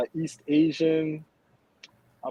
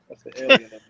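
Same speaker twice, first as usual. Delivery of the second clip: higher, louder joking punchline; excited punchline; 9 semitones higher, 9 dB louder, much faster than usual. Shown as a demonstration. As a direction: sell the joke with a big lift.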